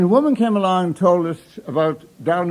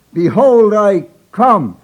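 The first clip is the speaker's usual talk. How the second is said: louder and higher, leaning into the pulpit; booming proclamation; 4 semitones higher, 9 dB louder, slower than usual. Retold or imitated. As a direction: boom it out over the pulpit. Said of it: "he breathed down on it".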